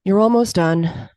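slow, sighing reassurance; weary resignation. Retold or imitated. Spectator to a runner on the track, wearily resigned. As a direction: say it slowly, as if given up on them. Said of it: "tone in which they were said".

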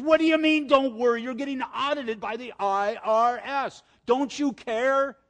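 high, loud, frantic mother; panicked mom. Retold or imitated. Mom panicking over the IRS audit, high frantic cry. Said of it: "my mom is just freaking out".

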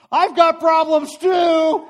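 high, very loud, drawn-out shout; yelled outburst. Retold or imitated. Speaker re-enacting an outburst at a whole plane, shouting. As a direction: blurt it out at full volume, stretching the words. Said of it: "I hear myself yell out".

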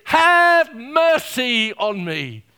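loud, slow, pleading cry. Acted. Blind man begging Jesus for mercy, slow loud plea. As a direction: drag out the plea at full voice. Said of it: "he cries this".

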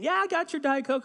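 high, loud chipper reassurance; hammed-up cheery husband; overeager promise. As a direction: sell it with big enthusiasm.

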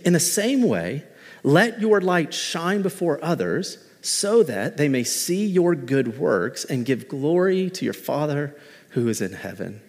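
slow, measured Bible reading; scripture reading. Acted. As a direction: read it out slowly like scripture. Pastor reads the scripture verse.

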